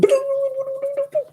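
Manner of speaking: high rattled-off gibberish imitating rapid chatter; mock gabble with the tongue rattling, no words; fast-talk babble. Imitating her Irish friend after seeing her sisters, fast mock babble. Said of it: "They talk so, so fast".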